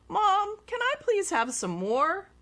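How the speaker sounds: lightly voiced little boy; boy asking. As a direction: plead sweetly like a hungry kid. Child voice asking mom.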